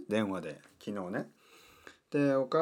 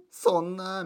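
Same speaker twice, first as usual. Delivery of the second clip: mock child surprise; 8 semitones higher, 3 dB louder, much slower than usual. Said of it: "みたいな感じで言ってたんですけど".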